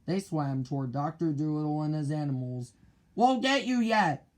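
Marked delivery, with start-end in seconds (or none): 3.1-4.3 high, loud threat; loud pirate threat; shouted threat. A narrator's reading. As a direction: yell it after them while swimming. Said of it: "Ben Ali shouted as he swam".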